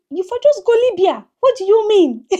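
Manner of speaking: high, loud, disbelieving; incredulous. Retold or imitated. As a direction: exclaim it in disbelief, high and fast. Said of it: "And I'm like".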